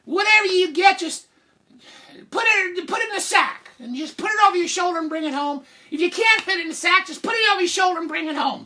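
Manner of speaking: high, fast, loud scolding rant; exasperated mother yelling. Acted. Mother telling Jack how to carry it home, high fast yelling. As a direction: yell instructions in one rapid high rush.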